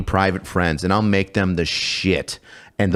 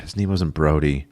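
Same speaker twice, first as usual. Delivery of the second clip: whispered aside; 5 semitones lower than usual. Real, not acted. Correcting a name, aside.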